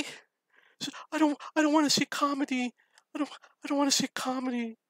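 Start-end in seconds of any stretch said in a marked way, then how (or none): 1.1-4.8 high, wailing mock sob; hammed-up crying voice of a young woman; imitating crying girl. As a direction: imitate her tearful wail, pitched way up. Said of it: "and she's crying".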